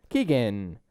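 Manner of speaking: usual speaking voice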